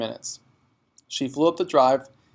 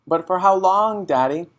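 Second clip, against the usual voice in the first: put-on anxious child; 7 semitones higher, 6 dB louder than usual. A narrator's reading. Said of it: "Matilda cried".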